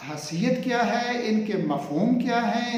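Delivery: ordinary delivery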